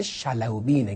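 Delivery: teacherly correction of شلوبینه; correct pronunciation. Shown as a demonstration. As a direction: say شلوبینه as Shalawbin, stressing the right form.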